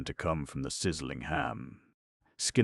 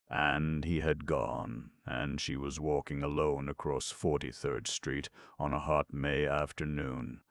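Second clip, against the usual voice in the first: ordinary delivery, nothing marked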